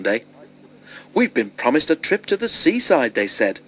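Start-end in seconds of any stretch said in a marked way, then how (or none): 1.1-3.1 higher-pitched, eager announcement; excited. A narrator's reading. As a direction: share thrilling news with eagerness. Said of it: "had been so excited".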